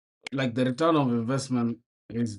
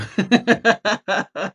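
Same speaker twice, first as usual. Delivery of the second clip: burst of laughter; 8 semitones higher, 11 dB louder than usual. Real, not acted. Viewers' joke cracks him up.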